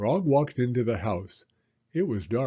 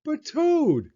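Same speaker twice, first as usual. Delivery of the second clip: high, loud plea; protesting; 5 dB louder than usual. Acted. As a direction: stop the friend from sleeping. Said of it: "said Frog".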